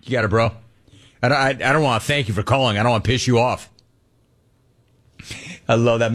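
usual speaking voice

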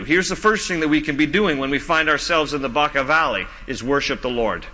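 ordinary talk, nothing marked

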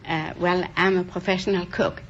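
usual speaking voice

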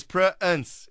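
"Prince" split into two loud, slow beats; two-syllable pronunciation. Shown as a demonstration. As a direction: say "Prince" as a drawn-out Pr-ince. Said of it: "I got two syllables".

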